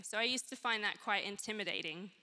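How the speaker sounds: ordinary talk, nothing marked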